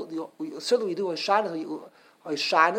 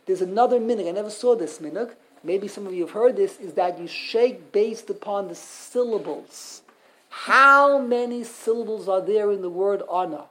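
ordinary talk

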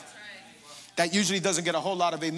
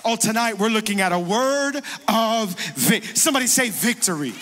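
louder, rising preacher call; louder call for the church to answer; energetic call and response; 4 dB louder than usual. Real, not acted. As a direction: stir the crowd to answer. Calls for response.

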